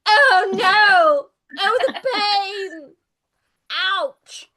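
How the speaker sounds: high, loud mock wail of pain; melodramatic wounded character. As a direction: play a struck hero hamming up the agony. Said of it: "And he's like".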